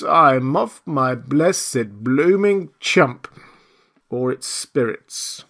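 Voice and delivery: slow, drawn-out moan; woeful lament. A narrator's reading. Marvel blaming drink or spirits, woeful moan.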